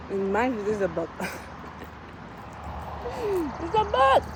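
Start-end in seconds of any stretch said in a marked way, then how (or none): 1.1-3.6 squeaky airy laugh, much higher; storyteller laughing at himself for crying over a book; high breathy laugh. Real, not acted. Laughing at own reaction. 3.6-4.2 squeaked high and loud through laughter; storyteller laughing that it was only a book. Real, not acted. Laughing at own reaction.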